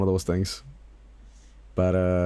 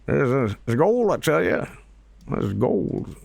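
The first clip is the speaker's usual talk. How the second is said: put-on old prospector voice; 3 semitones higher than usual. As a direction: play a gold-rush prospector. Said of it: "We got an old prospector guy".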